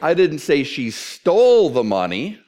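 slow and loud, "stole" drawn out; stress on STOLE. Shown as a demonstration. Preacher showing how emphasis changes meaning, stress on stole. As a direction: hit "stole" long and hard, ending on a rise. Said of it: "if I change the emphasis".

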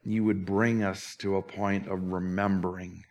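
reverent, slowed closing prayer. Acted. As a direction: pray it gently to close the service.

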